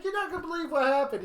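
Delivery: high, loud and fast; excited. Retold or imitated. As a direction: burst out with the news. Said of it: "it's like".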